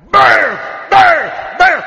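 repeated huge, high shouted blasts; explosive impact shouts. Acted. God knocks Pharaoh's gods down one by one, explosive shouts.

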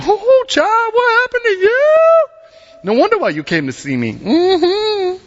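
high, drawn-out, knowing sympathy; pitying older woman. Retold or imitated. Imagined Elizabeth pitying pregnant Mary, high and drawn out. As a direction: cluck over her like a pitying aunt.

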